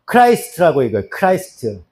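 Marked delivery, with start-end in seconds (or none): 1.1-1.8 크라이스트 repeated lower and softer, as English Christ; English pronunciation. Shown as a demonstration. Teacher repeating English reading for class, 크라이스트 said lower. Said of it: "영어로는 어떻게 읽냐면".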